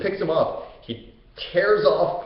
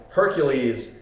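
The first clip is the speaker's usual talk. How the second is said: ordinary delivery, nothing marked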